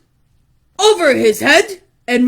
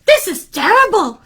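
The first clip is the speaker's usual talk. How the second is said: high, exclaiming motherly dismay; 7 semitones higher than usual. Acted. Mother reacting to runaway hair, dismayed. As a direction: raise the pitch in alarm. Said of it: "said his mother".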